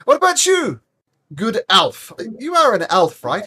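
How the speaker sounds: curious and chummy, sizing up the elf; friendly townsman. Acted. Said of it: "he says to Vinnick".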